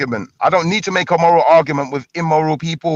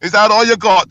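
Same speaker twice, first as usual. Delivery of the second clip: high, raised goading shout; loud taunt; 7 semitones higher, 4 dB louder than usual. Real, not acted. Arguer goading a rival over his insults, loud jeering. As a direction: jeer loudly, pushing him for more.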